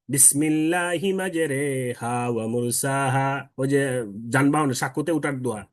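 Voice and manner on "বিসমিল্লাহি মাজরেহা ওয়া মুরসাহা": বিসমিল্লাহি মাজরেহা, slow, মাজরেহা with the alif leaned toward e; imala reading. Shown as a demonstration. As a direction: recite মাজরেহা drawn out with imala. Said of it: "তিনি বলেন মাজরেহা". (ইমালা করে ধীরে তিলাওয়াত)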